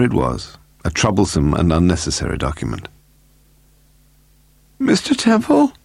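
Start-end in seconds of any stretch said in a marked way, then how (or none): 4.8-5.7 very high, strained woman calling; woman's voice. A narrator's reading. Woman in the hall asks for Mister Temple, high woman's voice. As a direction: call up in a high female voice. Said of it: "a woman's voice was asking".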